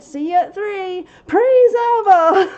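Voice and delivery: bright exultant reply; joyful praise. Retold or imitated. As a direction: cheer it out loud and high. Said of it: "The, the same as if she'd texted and said".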